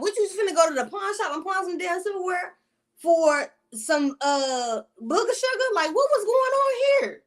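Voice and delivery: high-pitched, incredulous rant; retold exasperation. Storyteller questioning the silverware thief, exasperated. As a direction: pitch climbs in disbelief at the pettiness.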